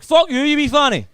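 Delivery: very high, loud furious scream; shrieked, hammed-up fury; lost temper. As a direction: scream in a comic rage. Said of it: "I lost my temper".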